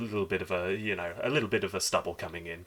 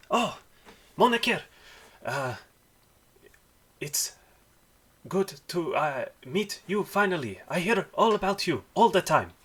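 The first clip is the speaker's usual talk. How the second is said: put-on high, loud, light child's voice; an eager little boy meeting someone at last; young dwarf boy; 10 semitones higher, 5 dB louder than usual. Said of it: "like a young person's voice".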